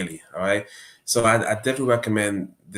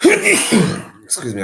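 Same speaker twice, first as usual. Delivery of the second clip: coughing fit, then excuse me; 9 dB louder, much slower than usual. Real, not acted. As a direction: cough out the words.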